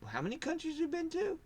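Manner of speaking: high, astonished rapid-fire questions; amazed questioning. Retold or imitated. Curious people quizzing him about his travels, high and amazed. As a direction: act stunned at what you hear. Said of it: "they go".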